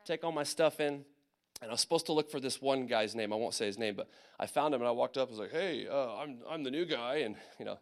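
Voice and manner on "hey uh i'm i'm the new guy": hesitant, mildly awkward greeting; dorky newcomer. Retold or imitated. New private introducing himself at his unit, dorky. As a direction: stumble shyly through introducing yourself. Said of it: "really dorky".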